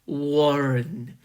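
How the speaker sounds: low, very slow unearthly voice; a hollow voice from the grave, dragged out; deep hollow inhuman voice. A narrator's reading. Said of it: "deep, hollow, ... inhuman, disembodied".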